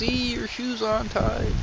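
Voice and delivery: high, slow put-on customer voice. Storyteller voicing a dim customer, high and slow. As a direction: play the dopey customer, high and drawn out.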